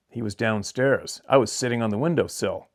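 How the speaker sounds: ordinary delivery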